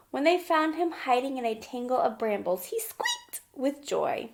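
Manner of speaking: put-on bright, lively read-aloud for little kids; gentle children's story narration; storybook reading. A narrator's reading. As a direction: read it like a picture book at story time. Reading picture book to children.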